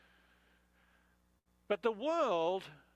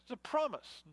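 ordinary talk

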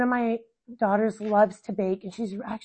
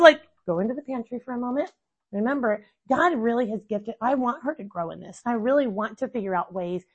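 ordinary talk, nothing marked

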